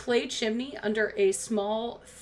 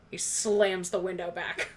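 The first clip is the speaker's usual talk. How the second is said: ordinary delivery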